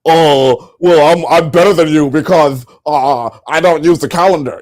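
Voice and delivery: sneering imitation of a smug know-it-all; mock smug voice. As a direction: parody a smug person bragging. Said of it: "are like".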